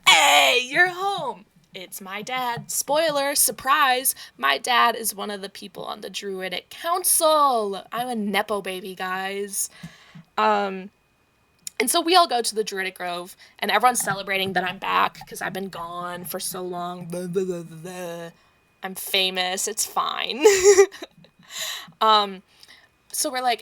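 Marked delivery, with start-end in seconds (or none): 0.1-1.4 loud, high welcoming call; hearty greeting. Acted. Elf dad greets his returning child, hearty and loud. 20.5-21.0 loud laugh. Real, not acted. Joking about being famous, a loud laugh.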